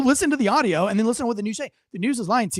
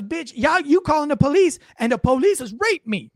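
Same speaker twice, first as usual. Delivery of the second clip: higher-pitched mock yell of a woman cursing on the phone; imitating angry girlfriend; 6 semitones higher, 3 dB louder than usual. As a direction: play the furious girlfriend, pitched up, half-hammed. Woman yelling on 911 tape.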